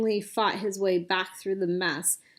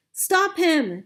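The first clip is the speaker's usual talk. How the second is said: high, loud yell; desperate yell; 8 semitones higher, 6 dB louder than usual. A narrator's reading. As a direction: yell to anyone within earshot. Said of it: "Chang Li yelled".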